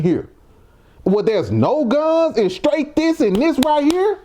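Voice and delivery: can't believe they don't fight back; worked-up incredulous rant. Real, not acted. Incredulous ranting.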